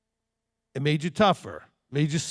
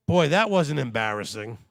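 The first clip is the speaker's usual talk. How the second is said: usual speaking voice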